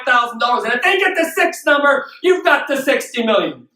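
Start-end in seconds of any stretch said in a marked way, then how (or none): none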